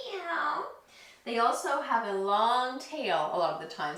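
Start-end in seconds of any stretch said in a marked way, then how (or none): 0.1-0.7 high rising kitty meow; playful meow up high; cat meow. Shown as a demonstration. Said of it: "So meow around your back".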